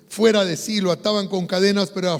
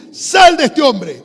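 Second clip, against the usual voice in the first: loud, drawn-out high command; commanding exorcism; 7 semitones higher, 11 dB louder, much slower than usual. Retold or imitated. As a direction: order the spirit out, slow and loud. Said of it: "Jesús ya le había dicho al espíritu". (orden de exorcismo a plena voz)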